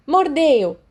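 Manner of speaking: high, loud sharp incantation; shouted spell. Acted. Crouch attacking Remus with a curse, shouting. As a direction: fling the curse out.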